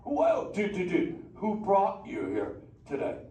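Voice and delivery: a hyped, easygoing beach dude of a turtle; Crush the sea turtle. Acted. Sea turtle greeting the kids in the theater, stoked surfer-dude voice.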